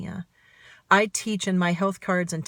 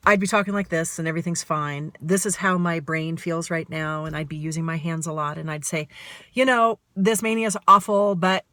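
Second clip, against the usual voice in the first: manic fast talk. Shown as a demonstration. Showing viewers how her manic brain sounds, fast and rushing. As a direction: rattle it off like a racing mind. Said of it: "that's what my brain sounds like right now".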